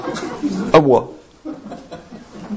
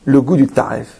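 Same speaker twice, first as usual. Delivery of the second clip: normal talking voice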